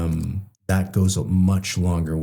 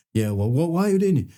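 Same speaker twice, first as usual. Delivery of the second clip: defensive; 8 semitones higher than usual. Retold or imitated. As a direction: voice shoots up into an indignant squeak. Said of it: "That defensive".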